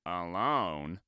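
low, soft rising Toad voice; Toad's puzzled question. Acted. Toad finding Frog's note, puzzled low question. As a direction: ask it low and baffled as Toad. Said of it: "said Toad".

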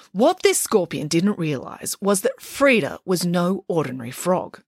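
usual speaking voice

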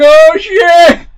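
high, loud exclamation; mock panicked yell. Player sliding in the mud sequence, mock panicked yell. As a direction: shout it in acted-out alarm.

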